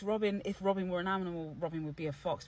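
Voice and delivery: normal talking voice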